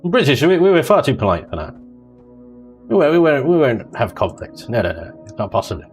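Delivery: ordinary delivery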